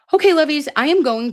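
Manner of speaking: high, loud cheerful call; bright sign-off. Real, not acted. Host calling her viewers to wrap up the night, chirpy. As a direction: call out to the chat. Wrapping up stream to chat.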